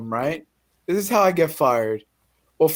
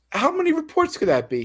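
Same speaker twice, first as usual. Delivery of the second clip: disbelief; 12 semitones higher than usual. Retold or imitated. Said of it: "I said".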